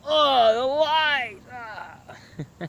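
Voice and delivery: high, wailing cartoon cry; mock alarm. Monsters caught in a flashlight beam, high mock-horrified wail. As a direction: wail in mock horror, then fade away. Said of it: "they all act all".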